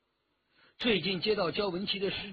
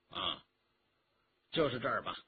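low, subdued approval; lordly old master; 8 semitones lower, 5 dB quieter, faster than usual. A narrator's reading. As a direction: settle on it in a deep, quiet voice. Lordly passenger inspects the cabin.

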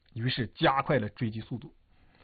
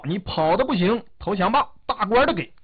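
put-on high, loud shout in stiff Japanese-accented Chinese; 生硬中国话喊话; 8 semitones higher, 5 dB louder than usual. A narrator's reading. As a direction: call out in a stiff Japanese accent, pitched well up. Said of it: "用很生硬的中国话对杨靖宇喊道". (日本口音的生硬中国话，高声喊话)

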